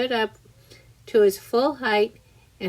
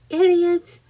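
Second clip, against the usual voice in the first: high, loud insult yell; 9 semitones higher, 6 dB louder than usual. A narrator's reading. Centipede yelling insults at the cloudmen, loud and high. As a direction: hurl it at them at full voice. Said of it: "he yelled".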